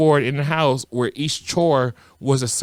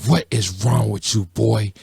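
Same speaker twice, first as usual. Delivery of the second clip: scolding father; 3 semitones lower than usual. Retold or imitated. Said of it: "he scolded me".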